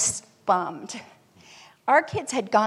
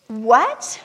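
shocked what; 7 dB louder than usual. Retold or imitated. Retelling the second declined card.

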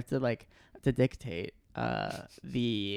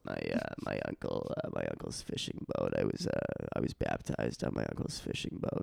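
much lower Boston voice, softer, playing a fourteen-year-old; Boston accent; 4 dB quieter than usual. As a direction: stay in the deep Boston bit, deadpan. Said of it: "I'm working on my Boston accent".

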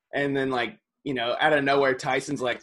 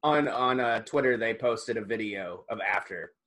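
ordinary talk, nothing marked

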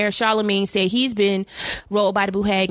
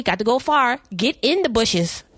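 ordinary delivery

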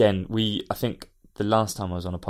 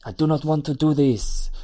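fake French accent; 6 semitones higher, 4 dB louder than usual. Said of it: "You're very good at this French stuff".

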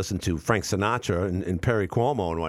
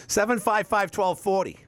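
laughing; 11 semitones higher than usual. Real, not acted. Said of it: "Oop, that's my phone number".

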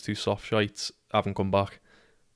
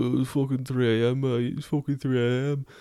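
mock moaning dealer; 3 semitones higher than usual. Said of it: "hear him fucking moaning a bit".

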